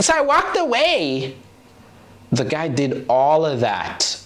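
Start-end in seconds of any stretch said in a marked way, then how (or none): 0.0-1.3 high squeaky mimic of a smug girl; mock bragging girl. Co-host mocking girls on the app, high. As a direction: sneer it in a thin put-on girl voice.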